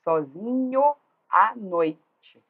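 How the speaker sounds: slow, forceful "Sozinho à" with "nho" and "à" run together; vowels joined. Shown as a demonstration. Teacher shows the joined syllable, slow emphatic "nho-à" in "Sozinho à". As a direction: beat it out slowly, gliding "Sozinho" into "à" before "noite". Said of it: "olha aqui que eu juntei".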